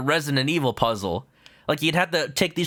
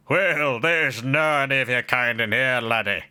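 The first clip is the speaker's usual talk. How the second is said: buff Scottish governor. Acted. Governor turning the lawyer away in a light Scottish accent. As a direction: sneer it like a burly Scot. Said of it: "A buff Scottish dude".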